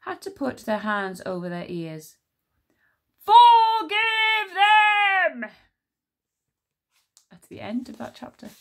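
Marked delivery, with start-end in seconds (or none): 3.2-5.5 high, loud, very slow booming roar; giant's roar. Acted. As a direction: bellow like a giant's dying breath. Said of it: "at a decibel so loud".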